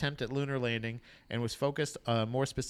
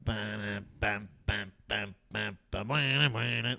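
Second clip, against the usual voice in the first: imitated music beats; 4 semitones lower, much slower than usual. Imitating music they played.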